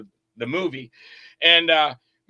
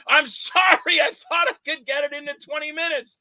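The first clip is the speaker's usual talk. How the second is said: pleading apology; 10 semitones higher, 4 dB louder than usual. Retold or imitated. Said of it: "And I go".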